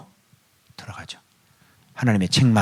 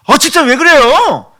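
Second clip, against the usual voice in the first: high, slow mock-annoyed retort; irritated snap; 10 semitones higher, 7 dB louder than usual. Preacher mimics a member snapping at another in church, mock irritation. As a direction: play a churchgoer losing patience.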